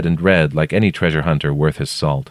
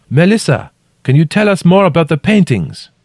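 high, loud called question; calling down; 11 semitones higher, 5 dB louder than usual. A narrator's reading. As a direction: shout down to someone out of sight. Narrator calling down into crack.

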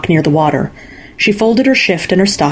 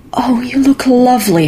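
breathed; 7 semitones higher than usual. A narrator's reading. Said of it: "her mother breathed".

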